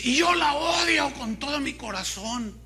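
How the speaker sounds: high-pitched mock outburst of hate; imitated bitter hatred. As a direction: act out the girl's heartfelt hatred. Imita el odio de la joven. (imitando odio apasionado, voz aguda)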